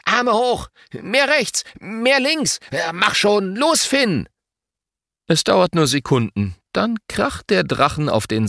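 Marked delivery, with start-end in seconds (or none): 0.0-4.3 put-on frantic child coach, volume held back; mock bellowing. A narrator's reading. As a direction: yell orders in storybook fashion, pitched way up. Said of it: "Leon beginnt zu brüllen".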